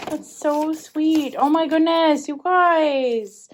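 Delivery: loud, high, thrilled exclaiming; overjoyed. Real, not acted. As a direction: gush with joy at the surprise. Opening surprise package from UK.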